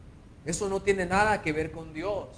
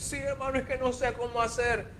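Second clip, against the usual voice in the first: mock helpless plea; 6 semitones higher, 3 dB quieter than usual. Mimicking a helpless believer.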